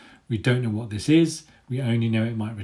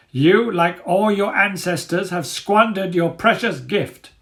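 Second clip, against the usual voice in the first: much higher, louder grand declaration; declaring dog leader; 9 semitones higher, 6 dB louder, slower than usual. Acted. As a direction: proclaim it like a menacing ruler. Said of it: "he declares".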